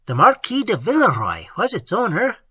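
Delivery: host says it emphatically; emphatic. A narrator's reading. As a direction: press the name home. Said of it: "replied La Voisin emphatically".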